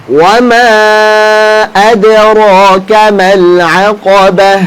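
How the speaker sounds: measured recitation with drawn-out vowels; Quran recitation. Shown as a demonstration. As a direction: recite for students to repeat, holding the long vowels. Recites Quran for class to repeat.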